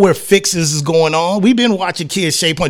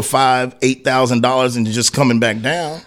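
usual speaking voice

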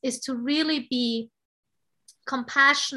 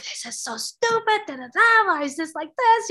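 high-pitched mock rant; frustrated ranting, imitated; 7 semitones higher than usual. Wife retelling her rant to husband, mock frustration. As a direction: re-enact a worked-up complaint in a raised pitch. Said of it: "I was so frustrated".

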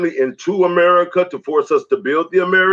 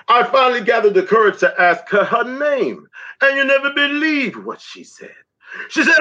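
rhythmic, declaiming poem delivery; spoken word poetry; 5 semitones higher than usual. Acted. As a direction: perform it as a slam poem, riding the beat.

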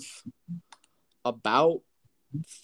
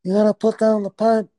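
high, mock mob godfather voice; 7 semitones higher than usual. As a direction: play a hammy old godfather. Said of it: "Godfather Don".

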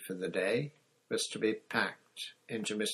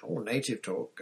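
ordinary delivery

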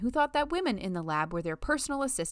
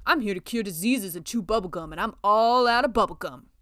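louder, growing into a mock tough-guy boast; action hero meme; 3 semitones higher, 9 dB louder than usual. Trishna parodying an action hero meme, hammed-up tough guy. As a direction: ham up the action movie one-liner. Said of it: "Like that meme with the dude".

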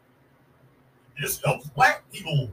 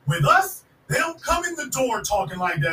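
ordinary delivery

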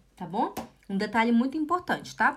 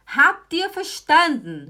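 calling out to class; 5 semitones higher, 8 dB louder than usual. Acted. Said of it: "teacher calls out to class".

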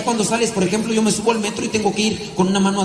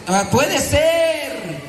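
sarcastic drawl; 7 semitones higher, much slower than usual. Real, not acted. Sarcastic aside.

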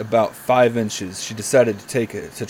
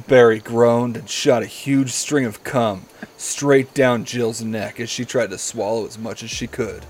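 ordinary talk

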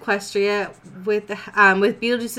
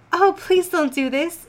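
gasping plea; 9 semitones higher than usual. Acted. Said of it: "she gasped".

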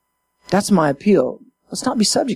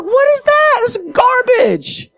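much higher, incredulous scoff; mock outrage; slower than usual. Pastor mocking twisted prophecy, high scoffing disbelief. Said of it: "I'm like".

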